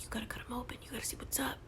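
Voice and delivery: soft, urging whisper; whispered inner voice goading him on; voice in his head. Retold or imitated. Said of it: "there's a voice in his head telling him".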